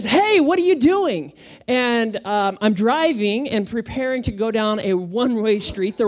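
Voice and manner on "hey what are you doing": higher, louder sudden "Hey"; wife's warning. Retold or imitated. Wife warning husband driving wrong way, sharp loud alarm. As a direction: call out to stop a driving mistake. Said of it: "Like when my wife says".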